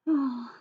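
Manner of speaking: long tired sigh; weary sigh. Acted. Sarah heading to school, weary sigh. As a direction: breathe out the dread.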